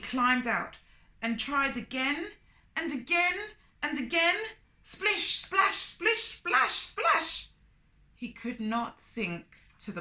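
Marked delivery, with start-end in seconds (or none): 2.7-4.4 each again higher and louder; building repetition. A narrator's reading. Repeated tries, building. 4.8-7.5 high, bouncy splashing words; playful splish splash. A narrator's reading. Splashing sound words in story.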